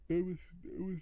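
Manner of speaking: words stretched through a yawn. Real, not acted. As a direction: talk mid-yawn, pitch rising. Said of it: "I don't know why I'm yawning".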